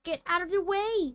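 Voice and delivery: a quick, irritated snap; snapped. Acted. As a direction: snap at the poltergeist. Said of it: "snapped Ron".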